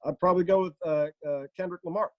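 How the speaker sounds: careful statesman weighing the answer; Barack Obama. Acted. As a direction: fill the gaps with halting uhs. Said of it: "here, ask Obama a question".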